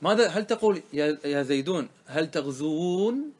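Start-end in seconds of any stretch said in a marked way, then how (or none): 2.0-3.3 تغزوون with a long drawn-out ū; two long waws. Shown as a demonstration. Teacher asks whether both waws stay, drawing out تغزوون. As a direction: stretch the ending of تغزوون, slowly, rising. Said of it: "هل تأتي بواوين؟".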